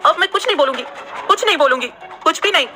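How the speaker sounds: high hurried promise, hand over mouth; scared promise. A narrator's reading. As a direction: promise silence in a fluster. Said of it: "अपने मुंह पर हाथ रख लिया".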